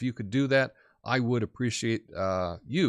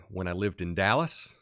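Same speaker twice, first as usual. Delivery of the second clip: normal talking voice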